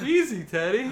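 loud laughing plea. Pretend. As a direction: call it out while laughing. Calming teddy, laughing loudly.

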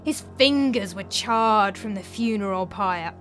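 slow, dramatic horror storytelling; Tranio spinning a ghost tale. Acted. Tranio describes a terrible apparition to his master, slow and dramatic. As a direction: draw out each dreadful detail.

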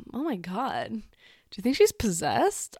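hushed, slow mock-scandalised gasp; mock dramatic gasp. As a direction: play it as breathless gossip. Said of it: "I wouldn't be like".

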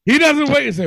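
ordinary delivery

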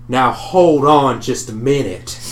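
slow Southern drawl; a Southern tailor drawling slowly. Acted. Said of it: "in a deep southern drawl goes".

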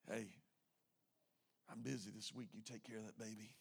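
low, hushed aside; furtive hand-off. Acted. Member quietly handing the baby to someone else, hushed. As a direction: pass the job on under your breath.

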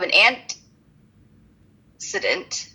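"antecedent" broken up slowly, piece by piece. Real, not acted. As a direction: sound out "antecedent" carefully, with a pause inside. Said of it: "I had to say it slow".

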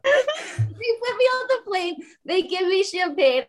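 high laughing talk. Real, not acted. Telling the friends, laughing high.

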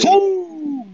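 high, drawn-out whoop of the team name; fan cheer. Pretend. Co-host cuts in on the team name, high fan cheer. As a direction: holler it like a fan in the stands.

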